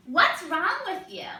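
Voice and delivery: put-on high, loud and slow, a small kid blurting it out; a little child asking a stranger, loud and high; curious little child. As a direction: play a blunt little child staring up at you. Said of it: "you hear a little child come up to you".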